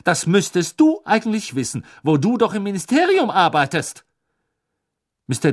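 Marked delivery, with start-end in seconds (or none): 0.1-4.1 indignant reproach, higher and louder; upset. A narrator's reading. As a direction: press the point, still worked up. (aufgebracht und vorwurfsvoll, höher)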